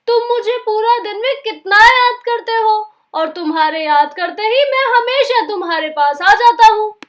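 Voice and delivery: lightly voiced Anger, coaxing; a hint of a sly friendly spirit; Anger character, friendly. Anger coaxing scared Sonu, lightly voiced friendly.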